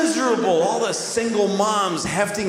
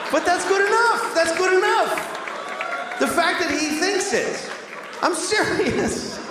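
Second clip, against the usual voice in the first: escalating comedy rant; 11 semitones higher than usual. Real, not acted.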